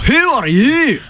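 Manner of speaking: put-on Scottish accent. Host in a mock Scottish voice.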